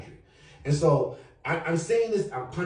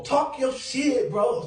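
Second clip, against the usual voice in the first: excited hype; 9 semitones higher, much slower than usual. Real, not acted. Hyping up the speaker.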